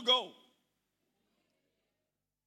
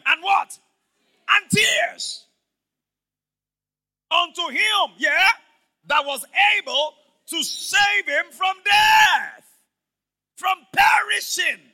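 loud, higher, slow preacher's cry; preaching at full force; 7 semitones higher, 14 dB louder, much slower than usual. Acted. Pastor preaching on Jesus' prayer, full force. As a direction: let each word ring out to the church.

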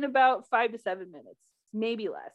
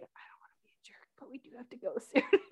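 hushed, voiceless aside; acted-out quiet nudge to leave; retold discreet whisper; 8 dB quieter, faster than usual. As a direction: whisper it apologetically. Partner's discreet request, acted out.

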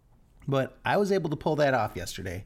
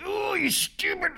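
sudden high furious shout at the toe; angry yell. Retold or imitated. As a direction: blurt it out high and angry, like the pain just hit. Stubbed toe, angry outburst.

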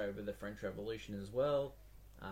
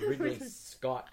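usual speaking voice

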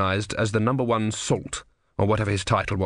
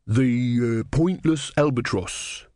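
plain reading voice

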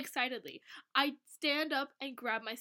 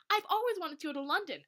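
high, excited exclamation; exclaiming; 6 semitones higher, 4 dB louder than usual. A narrator's reading. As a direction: read it with a big exclamation mark. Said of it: "Exclamation point".

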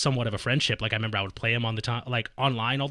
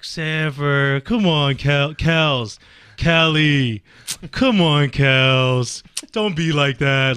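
put-on drunk and loud, pleading with a friend who is walking away; loud drunken pleading; 3 semitones higher, 10 dB louder, much slower than usual. Drunk teammate loudly begging his angry friend to stop, loud drunken pleading.

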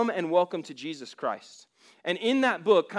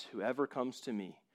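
gentle Christ; 7 semitones lower, 12 dB quieter than usual. Acted. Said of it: "And Jesus replies".

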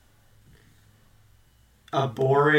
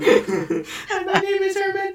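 high strained zombie voice; slower than usual. Pretend. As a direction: groan it out like a zombie. Repeats zombie intro.

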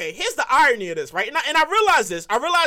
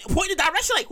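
ordinary delivery

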